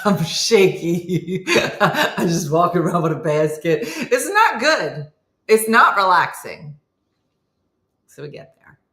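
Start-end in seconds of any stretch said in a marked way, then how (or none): 0.0-3.8 loud, laughter-broken telling; helpless giggling over the words; laughing through it. Real, not acted. Laughing at her own predicament. 3.8-6.8 loud, slow, emphatic protest; fed-up, drawn-out comic whine; exasperated complaint. Retold or imitated. Comic complaint about the walk.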